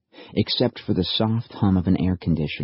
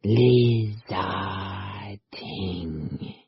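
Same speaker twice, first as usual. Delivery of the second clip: slow, strained creature mimicry; a creature parroting a man's voice; creature mimicking; much slower than usual. A narrator's reading. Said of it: "A surprisingly close approximation of his own voice".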